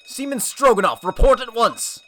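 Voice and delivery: high, loud barked order; commanding officer call. Acted. Officer demanding a report, loud commanding call. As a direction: call down sharply from the deck.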